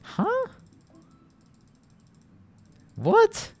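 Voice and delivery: high squeaky huh; shocked squeak; surprised. Real, not acted. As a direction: yelp in disbelief. Surprised at result.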